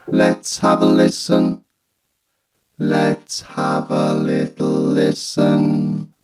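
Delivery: slow, drawn-out robot synth speech; vocoder voice. Shown as a demonstration. Reviewer showing high-end vocoding, slow robotic voice. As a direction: stretch the words through a vocoder. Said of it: "to vocoding".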